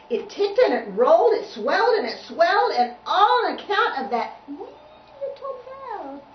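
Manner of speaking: put-on lively singsong read-aloud for little kids; reading to children. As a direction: read the rhyme with bouncy storyteller energy. Reads picture book to children.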